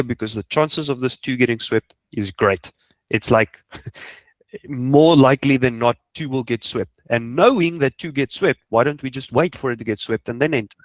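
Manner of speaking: ordinary delivery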